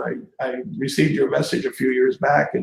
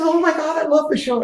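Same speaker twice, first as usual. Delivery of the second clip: much higher, breathless fan gush; 12 semitones higher than usual. Retold or imitated. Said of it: "just a gushing fan".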